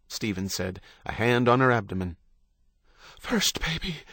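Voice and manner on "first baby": high, soft gasp. A narrator's reading. Wife in labour to her husband, gasping. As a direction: gasp it out between contractions. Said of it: "she gasped".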